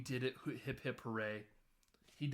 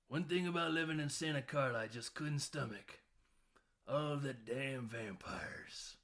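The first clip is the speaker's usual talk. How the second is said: grandpa impression. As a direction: deliver it as a weary grandpa, dragging the last words into a near-whisper. Acts Grandpa's deadpan closing line.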